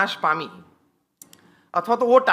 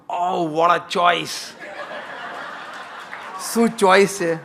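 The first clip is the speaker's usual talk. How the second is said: very slow, drawn-out delighted praise; hammed-up admiration with a laugh; gushing admiration; 2 semitones lower, slower than usual. Shown as a demonstration. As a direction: lay on the delight for your wife's choice. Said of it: "વધારે આનંદ લેવો હોય ને કરાવવો હોય તો".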